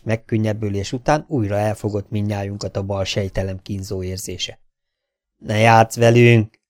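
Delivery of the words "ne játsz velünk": loud, slow, panicky plea; the doctor cries out at Ali, slow and loud; near-hysterical cry. A narrator's reading. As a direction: beg him to stop, close to hysteria. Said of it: "kiáltotta most már szinte hisztérikusan".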